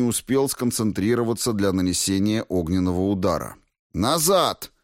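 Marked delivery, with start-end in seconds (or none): 3.9-4.7 higher-pitched quick order; sharp command. A narrator's reading. Fighter orders his creature to back off, sharp command. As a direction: call the beast back sharply.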